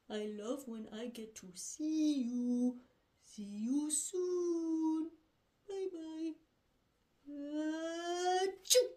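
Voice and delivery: soft, slow bear puppet voice; gentle bear talking to his cubs; Papa Bear voice. Acted. Papa Bear teaching his cubs about sneezing, soft voice.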